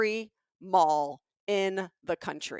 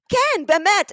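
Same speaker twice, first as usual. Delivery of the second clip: loud, high Hebrew outburst; visceral blurt; 13 semitones higher, 12 dB louder, slower than usual. Retold or imitated. Seller caught out answering in Hebrew, loud instinctive blurt. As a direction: blurt the answer before thinking. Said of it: "he responded in Hebrew 'cause it was visceral".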